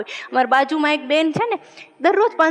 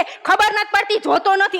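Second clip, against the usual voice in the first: indignant woman; 6 semitones higher than usual. Retold or imitated. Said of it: "બેન શું કે".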